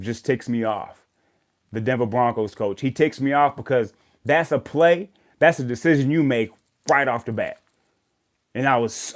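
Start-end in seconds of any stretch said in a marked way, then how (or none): none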